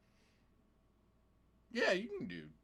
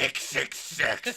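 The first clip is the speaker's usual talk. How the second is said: devil voice; much slower than usual. Acted. Joking devil number answer.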